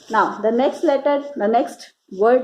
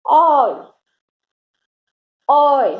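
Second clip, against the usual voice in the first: called-out oy; 2 semitones higher, 5 dB louder, slower than usual. Shown as a demonstration. Mother showing her little son the oy sound, called loud. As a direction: call ओय across the water. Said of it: "मैं ये sound इसको निकाल के दिखा रही हूँ".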